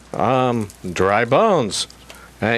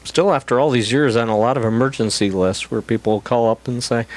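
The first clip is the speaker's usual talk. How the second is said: normal talking voice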